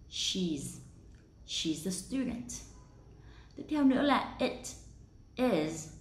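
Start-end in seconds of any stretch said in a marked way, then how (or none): 0.1-2.5 "she's" as one syllable; contracted she's. Shown as a demonstration. Teacher demonstrating the contraction, one-syllable "she's". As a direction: link the z of "she's" into a schwa. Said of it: "dạng rút gọn đọc là".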